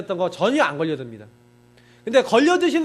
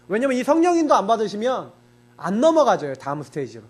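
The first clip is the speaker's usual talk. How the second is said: ordinary talk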